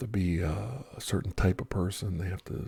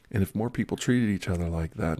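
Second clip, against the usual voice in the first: usual speaking voice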